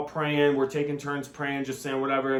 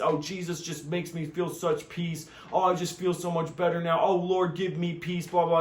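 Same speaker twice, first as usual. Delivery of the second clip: mock pious voice; 4 semitones higher than usual. Mocking contented churchgoer.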